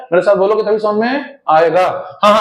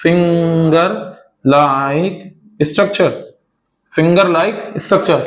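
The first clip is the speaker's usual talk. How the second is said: slow, word by word; 2 semitones lower, much slower than usual. Shown as a demonstration. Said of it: "कैसा है बताओ?".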